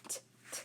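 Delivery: whispered "t" bursts, no vowel; bare unvoiced "t", spaced apart; isolated letter sound. Shown as a demonstration. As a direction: click out "t" twice, very softly. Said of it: "what do I hear?".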